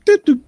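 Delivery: high-pitched imitated electronic beeps; small button beep. Game master showing what the button plays, high beeping doops. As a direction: mimic a little machine sound, high and punchy. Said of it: "it plays, like, a small little sound".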